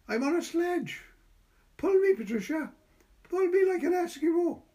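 put-on high, loud child's plea; begging child. A narrator's reading. Boy begging Patricia to pull his sledge. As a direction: beg like a small boy at play.

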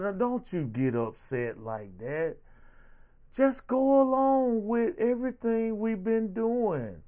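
high, soothing mock politician voice; mocking smooth talker. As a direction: coo it like a condescending politician. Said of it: "talk all calm and all smooth".

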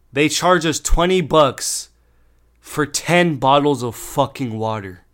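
slow, loud, emphatic outrage; indignant. Real, not acted. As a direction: punch out each word in disbelief at the bill. Outraged at the water bill.

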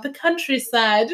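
high, slow British "countryside"; British accent. Shown as a demonstration. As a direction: draw out a put-on British accent. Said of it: "Or, like, a British accent".

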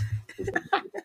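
giggling high; short squealing laugh; high squeaky laugh. Real, not acted. Laughs at own joke.

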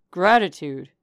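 loud outburst on one word; forceful, affronted cry; indignant exclamation. A narrator's reading. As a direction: burst out in protest. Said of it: "he ejaculated".

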